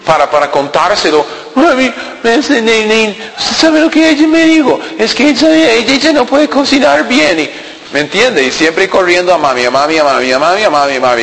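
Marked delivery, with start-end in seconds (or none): 1.6-7.4 put-on high, whining mama's boy complaining; whiny child voice. Preacher mocking a husband who runs to his mother, whiny high voice. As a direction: pitch it way up like a spoiled kid tattling to mom.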